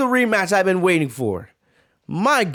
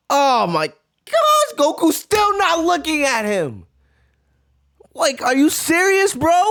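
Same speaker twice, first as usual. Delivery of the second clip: incredulous hype; 7 semitones higher than usual. Real, not acted. Reactor amazed Goku won't look, high disbelief. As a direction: stretch it out in disbelief.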